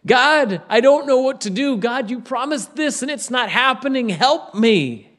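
acted desperate appeal; bold pleading prayer. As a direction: plead boldly, as if demanding help. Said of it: "boldly approach the throne of God and say".

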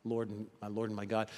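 low, soft awe; whisper-soft surrender; hushed confession. Acted. Thomas humbly confessing to Jesus, hushed. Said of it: "And he says".

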